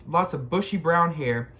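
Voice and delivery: ordinary delivery, nothing marked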